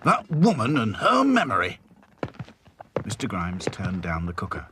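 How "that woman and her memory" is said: lightly voiced exasperated manager; grumbling boss. A narrator's reading. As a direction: tut at her forgetfulness. Grimes grumbling about Florrie.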